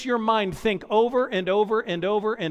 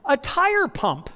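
proud discovery; 5 semitones higher, slower than usual. Retold or imitated. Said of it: "being like".

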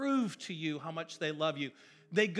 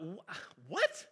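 incredulous what; 5 dB quieter than usual. Retold or imitated. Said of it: "I thought".

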